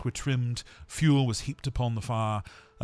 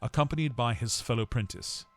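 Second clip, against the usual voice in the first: plain reading voice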